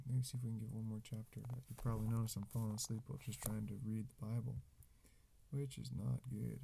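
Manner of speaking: usual speaking voice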